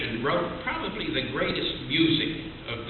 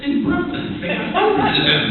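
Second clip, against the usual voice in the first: parrot voice; 6 dB louder than usual. Acted. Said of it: "And the parrot says".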